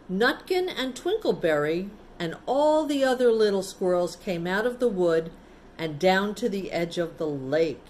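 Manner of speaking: ordinary delivery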